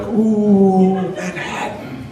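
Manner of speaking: high ooh dropping to a hushed name; mock awe. Canadian comic acting awed by New York, mock wonder.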